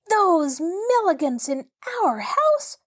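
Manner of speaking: high, drawn-out outrage; appalled cry. A narrator's reading. Mabel learns who gets their cottage, crying out appalled. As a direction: stretch it out in high, swooping disbelief. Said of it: "cried Mabel".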